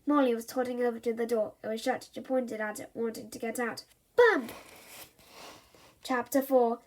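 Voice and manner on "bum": higher, louder cheeky blurt; toddler's rude outburst. Acted. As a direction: pop the word out like a naughty baby.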